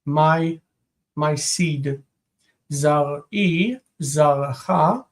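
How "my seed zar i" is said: ordinary delivery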